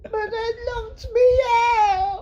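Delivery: high, slow sobbing whine; crying. Retold or imitated. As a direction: wail it like a weeping child. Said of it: "why do you say it like I'm crying".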